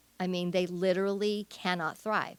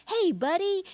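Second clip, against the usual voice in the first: bright greeting pitched much higher; playful Karen Purvis; 11 semitones higher, 4 dB louder than usual. Retold or imitated. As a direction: greet a child like a cheerful buddy. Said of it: "always talking to them and always in a".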